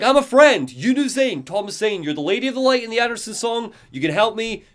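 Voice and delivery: high, urgent plea; anxious hammed-up plea; pleading Alan. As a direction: beg to be let in. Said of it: "Alan is like".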